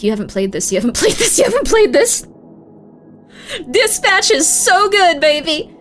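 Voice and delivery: retold gush that starts muttered and ends hammed-up; hyped texting. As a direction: keep pushing the same line until it bursts out. Retelling her texts while on meds.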